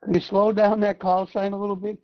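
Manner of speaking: usual speaking voice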